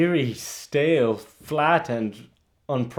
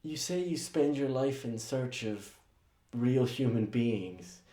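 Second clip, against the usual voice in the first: quiet bitterness; 11 dB quieter than usual. Acted. Assistant continues quietly.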